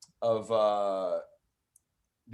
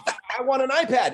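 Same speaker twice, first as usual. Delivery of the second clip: put-on child's wish; 12 semitones higher, 4 dB louder than usual. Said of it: "they'd be like".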